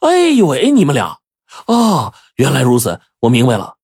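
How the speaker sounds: amazed dawning understanding; sudden realization. A narrator's reading. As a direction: gasp as it all clicks. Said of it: "恍然大悟".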